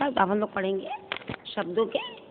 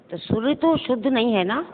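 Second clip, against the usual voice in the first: ordinary delivery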